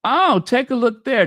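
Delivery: bright, loud exclamation; showing the photo with sudden delight; excited. Real, not acted. Streamer showing viewers a photo, excited call.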